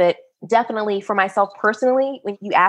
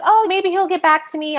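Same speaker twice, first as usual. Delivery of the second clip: hopeful inner voice; 10 semitones higher than usual. Retold or imitated. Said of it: "I was like".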